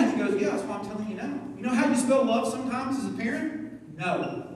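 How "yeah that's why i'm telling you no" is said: softer, lower, patient reply; a dad calmly explaining the no; calm fatherly answer. Retold or imitated. God gently answering the upset pastor, soft and patient.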